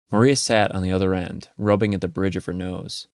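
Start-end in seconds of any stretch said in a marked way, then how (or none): none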